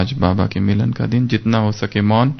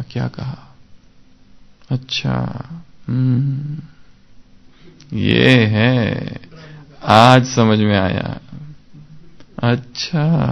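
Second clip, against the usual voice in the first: pondering aloud; much slower than usual. Pretend. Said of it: "अपने आप से ऐसे बात करनी है".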